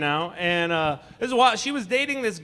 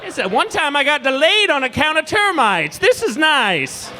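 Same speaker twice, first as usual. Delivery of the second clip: lightly voiced old codger, higher; old-timer voice; 6 semitones higher than usual. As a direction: reminisce like a small-town old man. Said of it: "some old guy's gonna be like".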